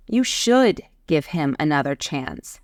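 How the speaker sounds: emphatic, weighty "should"; stress on SHOULD. Shown as a demonstration. Teacher showing obligation, strong stress on "should". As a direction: lean hard on "should". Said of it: "emphasize certainty, possibility, or obligation".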